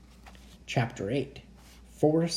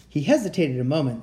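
usual speaking voice

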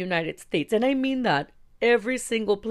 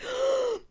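imitated gasp of shock; mock scandalized intake of breath. Band girls react to the cursing, shocked gasp.

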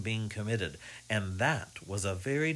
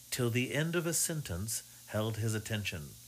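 plain reading voice

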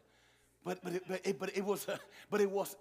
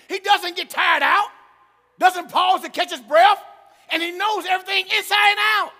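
loud, driving preacher; preaching at full force; 10 semitones higher, 15 dB louder than usual. Acted. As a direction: hammer each line home to the church.

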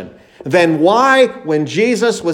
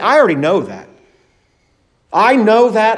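mock dismissive believer; imitating someone who won't be taught; unteachable know-it-all; 5 semitones higher, 3 dB louder than usual. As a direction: brush it off as already known. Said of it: "believers who are unteachable".

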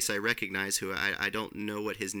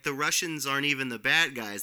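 ordinary talk

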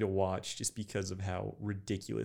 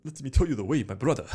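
louder mock Ugandan accent as Knuckles; 5 semitones higher, 9 dB louder, faster than usual. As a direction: meme Ugandan accent, playful. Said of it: "in a Ugandan accent".

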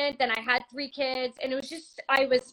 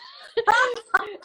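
high, loud burst of laughter; helpless high cackle; 7 semitones higher, 7 dB louder than usual. Real, not acted. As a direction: crack up at your own story. Laughing at her own story.

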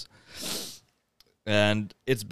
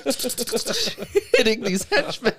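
high, drawn-out laughing speech; laughing while talking; 13 semitones higher, slower than usual. Real, not acted. Teller describes henchmen getting hit, laughing hard. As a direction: squeeze the words out through laughter. Cracking up at own retelling.

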